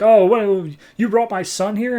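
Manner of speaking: high, loud outraged disbelief; shocked cop. Retold or imitated. Cop confronting Warblade about his son, shocked and high. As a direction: squeak out a father's shocked accusation. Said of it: "the cop arrives, and he's like".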